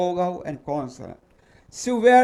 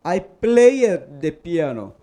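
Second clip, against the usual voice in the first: slow model sentence; 5 dB louder, slower than usual. Shown as a demonstration. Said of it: "Olha aqui".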